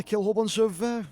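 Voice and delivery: ordinary delivery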